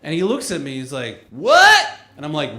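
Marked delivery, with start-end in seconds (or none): 1.3-1.9 very high, loud questioning yelp; cartoon hillbilly. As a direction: fire it back as a hillbilly challenge. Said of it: "cartoon hillbilly".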